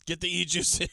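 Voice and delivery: hammed-up frantic order; John Cusack. Boss ordering miners to die for e-juice, mock frenzy.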